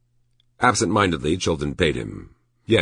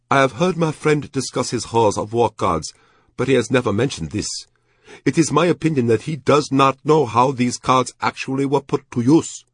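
high, louder, formal officer voice; Japanese major; 9 semitones higher, 4 dB louder than usual. A narrator's reading. Major remarking on his friend's card collection, high formal voice. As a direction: speak formally as the Japanese major, pitched well up.

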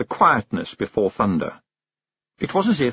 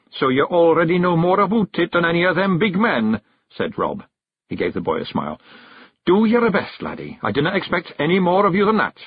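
higher Scots voice, gently encouraging; Rob, kindly gruff; 8 semitones higher than usual. A narrator's reading. Rob cheers young Billy on, encouraging Scots voice. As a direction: reassure the lad like a chief.

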